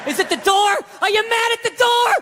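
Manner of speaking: high, keyed-up dog questions; eager dog voice. Acted. Loyal dog asking its angry owner, high and keyed-up. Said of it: "dog was over in the corner being like".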